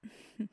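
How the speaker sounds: brief, airy chuckle. Acted. Hearing love returned.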